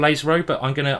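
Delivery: usual speaking voice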